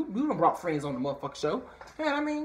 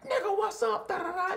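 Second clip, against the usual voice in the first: very high, crying-out hype greeting; excited greeting. Pretend. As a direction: shriek it with delight.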